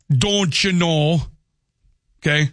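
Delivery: slow, drawn-out Upper Midwest "don't you know"; North Dakota accent. Acted. Host giving an example of regional accents, North Dakota accent. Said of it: "there's an accent everywhere".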